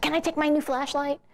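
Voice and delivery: put-on high, eager little-girl voice; six-year-old daughter. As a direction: imitate a small girl asking, pitched well up. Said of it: "my six-year-old daughter was there".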